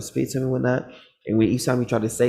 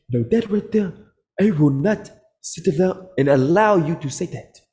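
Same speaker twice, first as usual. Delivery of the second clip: higher, slow, offended man's voice; a man standing up outraged; offended listener protesting his words; indignant objector; 6 semitones higher, 3 dB louder than usual. Retold or imitated.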